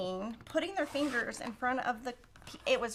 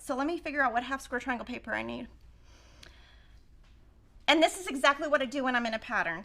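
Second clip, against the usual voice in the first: ordinary delivery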